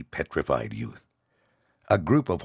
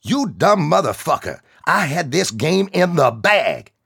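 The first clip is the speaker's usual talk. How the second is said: loud, high furious yell; enraged shout; 13 semitones higher, 9 dB louder than usual. Acted. Angry soldier yelling at the kid who spoiled his shot. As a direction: bellow at the clumsy kid. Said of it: "He became enraged".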